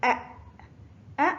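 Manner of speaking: "أ" ending abruptly on the hamza; sukun on hamza. Shown as a demonstration. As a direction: say "أ" as a short vowel cut off by a glottal stop. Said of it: "فنقول". (نطق "أ" بهمزة ساكنة)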